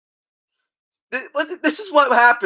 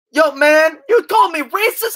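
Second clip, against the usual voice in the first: higher, indignant yo man; 8 semitones higher, 3 dB louder than usual. Retold or imitated. Jay-Z answers, offended.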